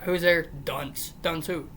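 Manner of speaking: normal talking voice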